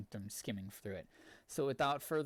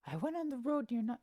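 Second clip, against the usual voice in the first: put-on Russian accent; 12 semitones higher, 3 dB louder, faster than usual. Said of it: "with a Russian accent".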